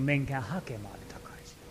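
whisper. Retold or imitated. God's reply, whispered.

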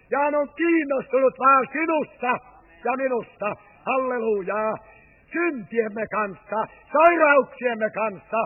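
impassioned preaching. Acted. Preacher invites the congregation to the Father's lap, fervent preaching. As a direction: call the listeners in with fervour.

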